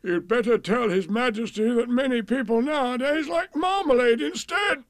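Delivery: high, loud, put-on cow voice; comic high-pitched sleepy cow. A narrator's reading. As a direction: moo out advice like a drowsy cow. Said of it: "The Alderney said sleepily".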